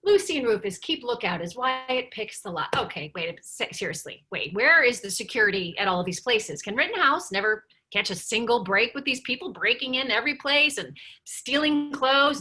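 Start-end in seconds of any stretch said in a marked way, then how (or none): none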